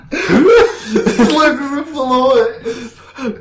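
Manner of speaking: high squeal through laughter; laughing. Real, not acted. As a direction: squeal it while cracking up. Listener cracking up at reading.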